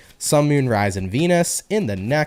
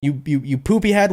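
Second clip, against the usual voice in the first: high playful curse dropping into silly name-calling; half-hearted insult in a good mood; mock angry retort; 6 semitones higher than usual. Retorting to somebody who pisses you off, mock angry. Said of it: "somebody pisses you off".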